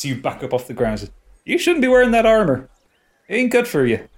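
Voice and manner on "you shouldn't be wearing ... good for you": dwarf voice. Acted. Dwarf NPC advising.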